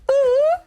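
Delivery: loud falsetto ooh; high ooh noise. Retold or imitated. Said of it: "this noise behind me".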